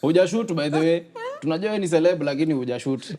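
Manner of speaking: high, loud nagging boss voice; pushy boss. Retold or imitated. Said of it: "your boss passes".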